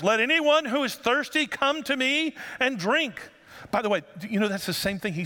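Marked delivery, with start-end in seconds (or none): 0.0-3.2 raised, higher, drawn-out proclamation; loud voice. Retold or imitated. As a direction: call it out slowly to a festival crowd. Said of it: "he said in a loud voice".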